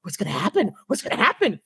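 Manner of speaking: hushed, high imitation of children on edge; mock suspense, rising in pitch on the repeat; like kids on the edge of their seats. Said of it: "at their edge of their seats".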